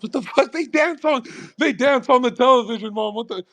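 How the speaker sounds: high-pitched comic disbelief; mock-scandalized kid complaint with a laugh; retold outraged outburst. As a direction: protest to mom, pitch shooting way up. Retold outburst at mom over TV.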